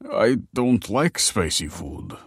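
put-on slow, querulous old man; slow, fussy complaint; grumpy old man. A narrator's reading. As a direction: refuse it like a sulky old man. Old man refuses his plate.

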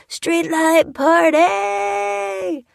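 high, very drawn-out party shout; long whooping holler, as if disappearing away; diminishing cry. A narrator's reading. Revellers leaving the microphone's range, diminishing cries. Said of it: "with diminishing cries of".

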